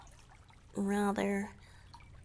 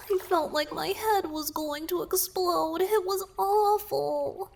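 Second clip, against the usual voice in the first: higher and louder, fed-up outburst; exasperated; 9 semitones higher, 5 dB louder than usual. Acted. Merman complaining to his savior, exasperated. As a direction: vent about the overwhelming serenades. Recalls nine merfolk singing at once.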